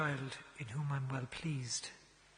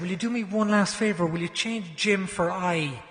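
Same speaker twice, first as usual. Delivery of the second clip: loud appeal; 4 semitones higher, 11 dB louder than usual. Retold or imitated. Asking the last favour, loud.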